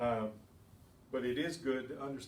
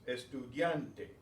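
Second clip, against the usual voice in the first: slow "Estudiante", stressing "dian"; stress on next-to-last syllable; slower than usual. Shown as a demonstration. Teacher models stress for students, "Estudiante" slowly, stressed on "dian". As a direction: draw out "Estudiante" and lean on "dian". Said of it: "stressed on the next to the last syllable".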